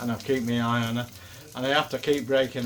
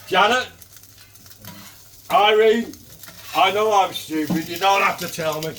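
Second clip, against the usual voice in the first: calling out; 6 semitones higher, 6 dB louder than usual. Pretend. Calling out to two mates.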